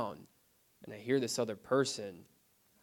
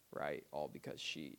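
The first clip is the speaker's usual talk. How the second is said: ordinary talk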